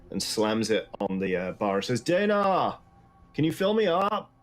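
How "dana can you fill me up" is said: gruff tavern keeper calling out; barkeep call. Acted. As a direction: call across the bar to Dana. Barkeep Dale calls to Dana.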